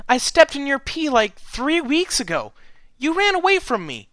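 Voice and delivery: high and loud accusing cry; shouting. A narrator's reading. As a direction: yell it to the whole crowd. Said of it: "at the top of her lungs to the entire crowd".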